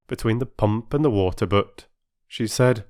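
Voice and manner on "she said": ordinary delivery, nothing marked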